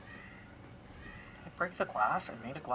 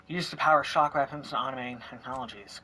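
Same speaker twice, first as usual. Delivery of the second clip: usual speaking voice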